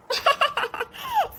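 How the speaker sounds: long high giggle to tease; teasing giggle. Acted. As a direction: laugh at her shyness. Bhabhi laughs teasing Reeta.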